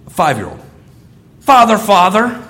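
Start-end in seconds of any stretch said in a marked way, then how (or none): 1.4-2.4 mock stiff child call. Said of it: "run toward their parent and go".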